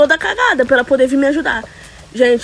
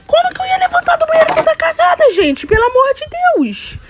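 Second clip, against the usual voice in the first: high exasperated wail. Real, not acted. Telling viewers about the church disaster, high-pitched exasperated wail. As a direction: voice jumps way up in outrage. Outraged retelling of embarrassment.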